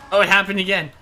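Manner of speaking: loud, high burst of delight; excited exclaim. Real, not acted. Gamer watching a rocket hit again, loud excited shout. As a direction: blurt it out, thrilled.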